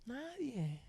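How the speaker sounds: very soft single word; hushed answer. Real, not acted. Preacher answering who would encourage David, hushed. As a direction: drop to a near-whisper after the question.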